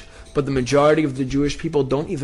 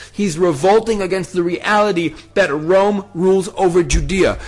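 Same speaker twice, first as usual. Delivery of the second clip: louder, higher fervent refrain; impassioned preaching; 7 semitones higher, 5 dB louder than usual. Acted. Preacher hammering Akiva's revolt against Rome, loud and high. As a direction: drive the repeated line home with fire.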